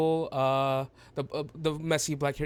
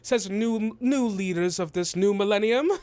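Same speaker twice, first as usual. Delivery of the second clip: high, loud, proclaiming voice; pompous, high-pitched greeting; grandiose halfling dignitary; 8 semitones higher, 6 dB louder than usual. Acted. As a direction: hail the crowd like visiting royalty. Game master plays grandiose halfling.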